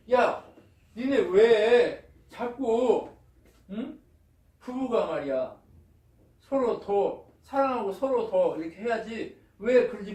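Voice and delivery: loud, slow, exasperated telling-off; acted scolding. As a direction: scold the couples with stretched, heavy words. Paul scolding married couples, acted. (큰 소리로 느릿느릿 나무라듯)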